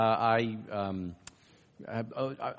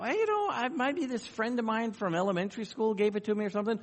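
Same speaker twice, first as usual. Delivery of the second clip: put-on much higher, quicker voice for the young student; voicing the student; 9 semitones higher than usual. Interviewer retelling a student's puzzled answer, in a high voice. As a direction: play the puzzled college girl, light and high.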